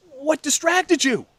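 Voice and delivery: high, loud, drawn-out question; imitating a furious sibling; exasperated demand. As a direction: demand an answer in acted outrage. Furious questioning of brother, retold.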